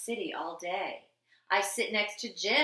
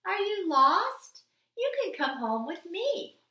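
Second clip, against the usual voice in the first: a man kindly offering a lost dog a home; kind stranger; 10 semitones higher than usual. Acted. Man gently asking a stray dog if it's lost. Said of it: "He came over and said".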